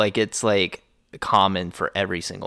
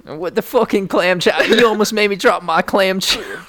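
higher mock-angry Boston rant; angry Bostonian; 9 semitones higher, 4 dB louder than usual. Friend mocking Boston chowder lovers, hammed-up Boston anger. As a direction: rant like a riled-up Boston local. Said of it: "Boston impression".

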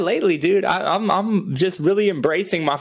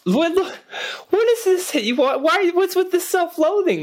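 mock concern; 11 semitones higher than usual. Mock concern, pitched high.

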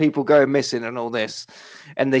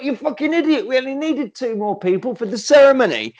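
higher, sneering telling-off; mock villain scolding; 8 semitones higher than usual. Villain telling off the butler, mock angry scold.

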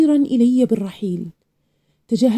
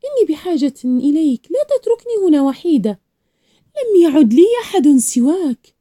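high-pitched pleading, building louder to the end; desperate cry; 6 semitones higher, 4 dB louder than usual. A narrator's reading. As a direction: beg the grandfather not to leave, voice rising. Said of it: "صرخت".

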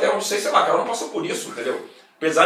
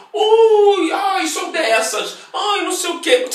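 high, hammed-up flirt; mocking flirty voice; 12 semitones higher, 7 dB louder, much slower than usual. Mocking fans who comment on his Instagram, in a high flirty voice. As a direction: squeal it like a gushing fan.

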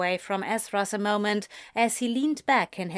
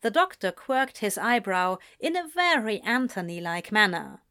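ordinary delivery, nothing marked

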